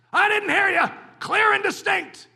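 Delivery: loud, high barking sergeant; drill sergeant. Acted. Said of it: "Didn't hear you, soldier".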